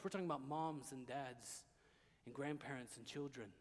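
low, soft, sober sermon voice; hushed preaching. Real, not acted. Preacher reminding listeners these are real people, hushed preaching. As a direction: bring it down to quiet gravity.

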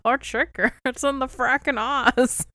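loud, hammed-up mock outrage; booming voice, shocked. Booming voice furious about a chicken in Oz, hammed-up. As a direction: draw it out loudly, nearly laughing. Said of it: "the booming voice goes".

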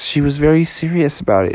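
ordinary delivery, nothing marked

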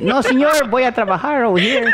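put-on high, hammy Mexican accent; mock Mexican accent. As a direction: play a day laborer in a Mexican accent.

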